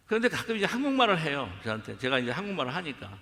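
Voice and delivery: ordinary talk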